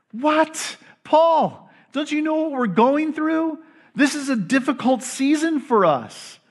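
high-pitched, disbelieving objection; incredulous protest. Acted. Struggling church answers Paul, incredulous protest. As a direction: push back in disbelief, pitched well up.

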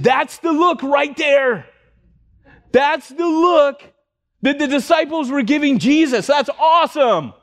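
high, drawn-out triumphant cry; exultant preacher's shout. Retold or imitated. Retold outburst at the stunned congregation.

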